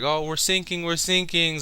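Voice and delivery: high-pitched mock panic; Chopper, mock scared. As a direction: imitate a frightened little wail, pitched well up. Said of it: "Chopper's still as scared as ever".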